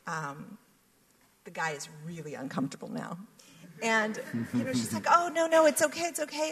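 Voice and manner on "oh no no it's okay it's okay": flustered protest. Retold or imitated. Woman protesting the offer.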